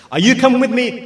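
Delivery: high, loud retold question; retold rallying call. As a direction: call it out as if summoning followers. Retold call to each person.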